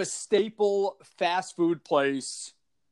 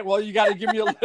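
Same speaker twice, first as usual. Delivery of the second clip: ordinary delivery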